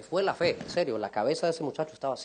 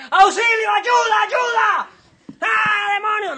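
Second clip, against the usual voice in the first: high, strained, hammed-up screaming; mock cries for help; 18 dB louder, slower than usual. Freed man pinned by church brothers begs for help, hammed-up screaming. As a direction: scream for rescue in comic panic.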